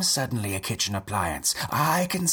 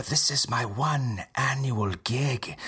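ordinary talk, nothing marked

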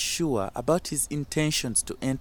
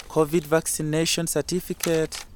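ordinary delivery, nothing marked

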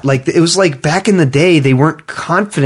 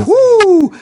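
woo; 4 dB louder than usual. Real, not acted. Reacting to huge budgets.

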